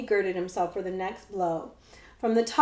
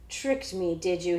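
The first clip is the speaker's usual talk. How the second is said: ordinary delivery, nothing marked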